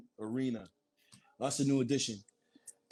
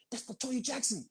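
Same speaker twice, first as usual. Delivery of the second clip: high-pitched mock amazement; imitated starstruck excitement; 10 semitones higher than usual. Starstruck sighting acted out.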